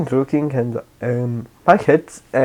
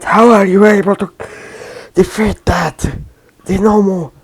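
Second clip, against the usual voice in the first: higher, stunned question; shocked villain; 7 semitones higher than usual. Retold or imitated. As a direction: disbelief at the creature's defeat. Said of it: "Shigaraki is like".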